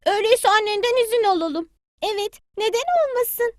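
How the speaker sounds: high, eager little chick; a small child calling at granny's door. Acted. Bili Bili greets granny hen at her door, high child voice.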